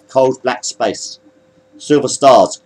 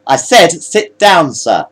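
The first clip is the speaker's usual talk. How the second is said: insistent order; 6 semitones higher, 4 dB louder, slower than usual. Acted. Armed officer repeats his order.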